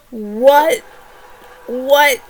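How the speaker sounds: loud, incredulous what; disbelieving reaction. Retold or imitated. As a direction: burst out in disbelief. Reacting to shocking plot twist.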